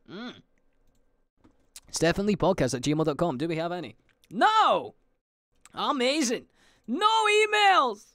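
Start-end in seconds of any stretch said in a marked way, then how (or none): 4.3-8.1 high, hammed-up mock glee; fake-celebrate the empty inbox; sarcastic delight. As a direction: ironic cheer, drawn-out "No emails". Mock joy at no emails.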